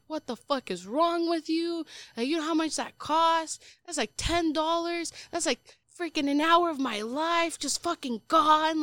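high-pitched outraged rant, retold; losing it. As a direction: re-enact an indignant outburst, pitch up. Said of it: "I lost my shit".

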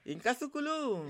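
loud, high chanted call-out; ringing call, high then falling; storyteller's call. Shown as a demonstration. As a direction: call out to the audience for their response. Storyteller's call for audience response.

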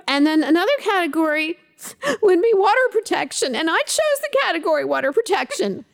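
put-on a hiccuping little girl defending herself with Jeopardy categories; tearful five-year-old insisting on her logic; indignant child. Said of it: "Like, very indignant".

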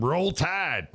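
high Southern Roll Tide; Southern accent. Pretend. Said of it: "Southern accent".

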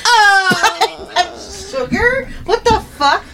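high-pitched surprised squeal; high squeaky voice. Acted. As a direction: squeak it out in disbelief. Reacting in a mock squeal.

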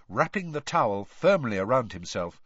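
plain reading voice

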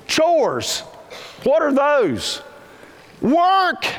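put-on high whiny kid voice, drawn out; mock kid. Preacher mimics a kid groaning at chores. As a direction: whine like a kid hearing about chores.

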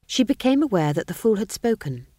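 plain reading voice

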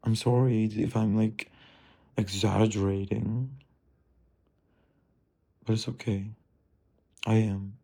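normal talking voice

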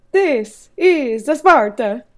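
slow, loud, hammy Italian battle line; Mario. Acted. Auditioning for the role with a movie line, slow Mario voice. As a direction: announce it word by word as a cartoon plumber. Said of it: "auditioning for the role of Mario".

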